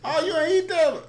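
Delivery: hammed-up catching the kid; imitating a parent. Parent catching him not eating, mock surprised high voice. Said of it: "I got caught one time".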